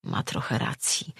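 soft, low, almost voiceless thought; inward admission. A narrator's reading. Woman silently conceding his point, hushed. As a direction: concede it softly to yourself. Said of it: "przyznała w duchu".

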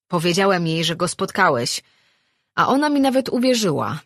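lively tavern-goer ribbing a friend; sociable Marin, teasing. Acted. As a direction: tell it with amused disbelief. Voices Marin teasing old man.

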